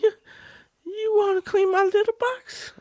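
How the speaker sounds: very high, tearful cat lady; squeaky old cat lady; crazy cat lady voice. Acted. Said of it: "I am a crazy cat lady".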